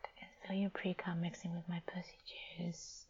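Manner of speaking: soft, quiet whisper. Acted. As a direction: whisper it close. Intimate whispered roleplay.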